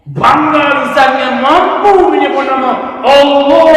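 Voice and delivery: preacher exalting the tongue that names Allah, loud; rousing preaching. Acted. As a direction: proclaim it with pride.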